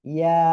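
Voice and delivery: loud ইয়া, final "aa" held one count; lengthened vowel. Shown as a demonstration. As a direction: model ইয়া firmly on a level note. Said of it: "এক আলিফ টান হবে".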